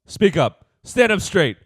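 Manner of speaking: high, loud bossy mother barking orders; tiger mom. Retold or imitated. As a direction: boss him around like a strict tiger mom. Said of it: "She's a tiger mom".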